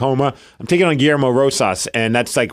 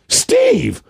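high, sudden call of a name; sudden delighted yell of recognition; excited shout of recognition. Retold or imitated.

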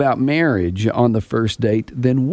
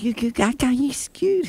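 put-on high babbling baby talk, softer; 10 semitones higher, 4 dB quieter than usual. Shown as a demonstration. Host demoes real baby talk, high and soft. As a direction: babble it like talking to an infant. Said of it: "Baby voice is like".

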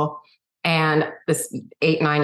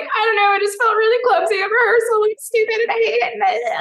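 imitating sobbing. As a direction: whine it out like crying. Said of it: "I was like".

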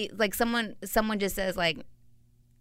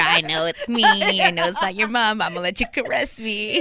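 louder, laughing playful impersonation; laughing mock voice; 2 semitones higher, 5 dB louder than usual. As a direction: tease him through laughter. Voicing Ronan's imagined thoughts.

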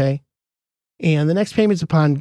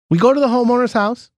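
ordinary delivery

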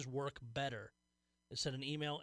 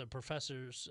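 ordinary talk, nothing marked

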